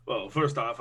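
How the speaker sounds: usual speaking voice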